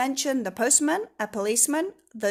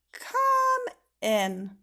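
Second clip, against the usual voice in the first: high, sing-song; sweet welcome; 13 semitones higher, 4 dB louder than usual. Acted. Miss Magic welcomes Mister Tickle, high and sweet. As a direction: greet him brightly.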